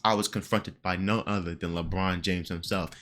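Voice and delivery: low, soft mocking echo with the pause; mocking imitation. Streamer mocking the host's pause, low and soft. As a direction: parrot him back low and quiet. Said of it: "You see that little pause?".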